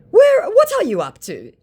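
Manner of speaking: high, loud silly scammer voice; mocking a clumsy scammer. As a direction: squeak out the scammer's dodge. Voicing the scammer.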